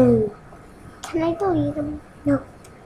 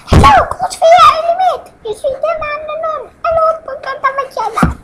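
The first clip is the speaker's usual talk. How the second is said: put-on much higher, fast girly voice; high little girl voice; 12 semitones higher, 5 dB louder, faster than usual. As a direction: squeak it like a tiny girl. Child plays little match girl.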